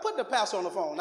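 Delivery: a hint of putting on the pastor's voice; mock pastor demand. Son asking mama for his pastor father, mildly mock stern. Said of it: "I started talking like".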